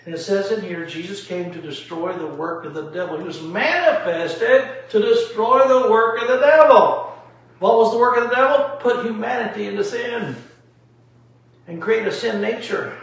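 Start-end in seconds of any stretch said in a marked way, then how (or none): 3.4-10.4 raised, louder preacher's delivery; emphatic preaching. Real, not acted. Man teaching why Jesus came, emphatic preaching. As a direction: drive it home with rising force. Preaching his key point forcefully.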